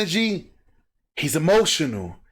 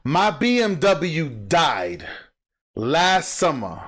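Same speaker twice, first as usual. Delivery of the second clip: slow emphatic telling; 2 semitones lower, much slower than usual. Real, not acted. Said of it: "repeated slowly".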